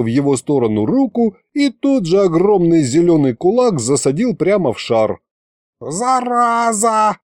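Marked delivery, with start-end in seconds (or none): 5.8-7.2 high, long-drawn yell at modest volume; wailed curse. A narrator's reading. Sorcerer punched and fleeing, high wail. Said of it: "завопил колдун".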